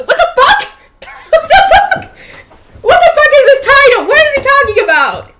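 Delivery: real laughter, very high and loud; incredulous laughing outburst. Real, not acted. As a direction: shriek in baffled amusement, laughing through it. Reader cracks up at playground remark.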